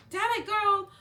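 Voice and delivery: high, loud mock frustration; exasperated outburst. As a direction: re-enact snapping at a pushy seller. Acted exasperation at pushy seller.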